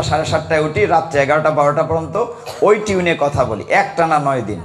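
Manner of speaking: ordinary delivery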